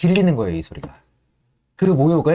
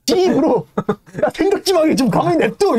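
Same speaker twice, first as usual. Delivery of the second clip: higher, louder brush-off with a laugh; lightly voiced irritated stoker; annoyed Yank; 14 semitones higher, 4 dB louder than usual. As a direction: tell them to leave him alone. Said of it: "앙크가".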